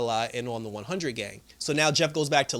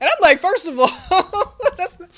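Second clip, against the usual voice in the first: loud, high, cracking up mid-objection; laughing protest; 10 dB louder than usual. Real, not acted. Viewer reacting to Lynn's outburst, laughing too hard to finish. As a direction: object while helpless with real laughter.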